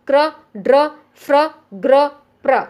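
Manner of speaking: "क्र, ड्र, फ्र, ग्र, प्र" each as one short syllable; one blended sound. Shown as a demonstration. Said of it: "इसको एक साउंड पढ़ना है".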